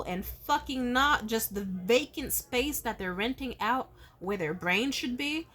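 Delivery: normal talking voice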